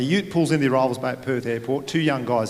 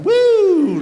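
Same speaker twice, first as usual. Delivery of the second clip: very high, loud whooping cheer; a hammed-up joyful woo; mock whoop; 9 dB louder than usual. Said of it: "Mum didn't start going".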